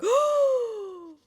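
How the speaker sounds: high, drawn-out mock "oh"; mock gasp of realisation. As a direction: act out a sudden delighted discovery.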